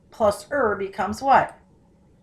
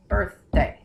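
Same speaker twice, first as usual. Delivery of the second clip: ordinary delivery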